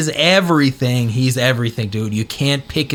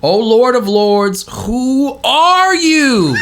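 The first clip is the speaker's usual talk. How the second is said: much higher, louder, slow cry to the heavens; yelling prayer; 8 semitones higher, 4 dB louder, slower than usual. Retold or imitated. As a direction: hurl the question upward in despair. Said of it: "He's yelling. He's screaming right now".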